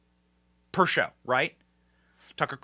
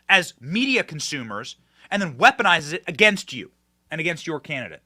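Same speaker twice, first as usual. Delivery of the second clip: usual speaking voice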